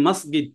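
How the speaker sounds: মসজিদ with a hard g, "masgid"; Egyptian pronunciation. Shown as a demonstration. Teacher recalling the Egyptian example, মসজিদ said with hard g. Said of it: "একটু আগে বললাম যে".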